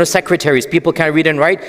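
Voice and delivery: ordinary delivery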